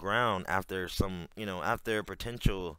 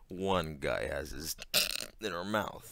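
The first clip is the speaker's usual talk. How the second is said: laughing; 6 semitones lower, 4 dB quieter than usual. Real, not acted. Repeats the narrator's line while laughing.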